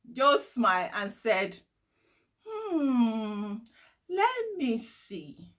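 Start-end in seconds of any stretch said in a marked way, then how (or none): 2.5-5.5 thoughtful hmm. A narrator's reading. Joe ponders for Riley, slow musing voice. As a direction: take your time thinking.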